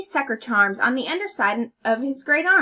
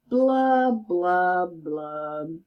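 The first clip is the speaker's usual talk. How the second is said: sinking blubs; 5 semitones lower, much slower than usual. Acted. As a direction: let the blubs sink and die out. Fish's sad refrain.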